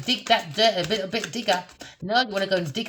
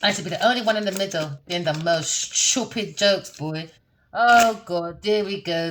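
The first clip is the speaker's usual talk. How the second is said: ordinary talk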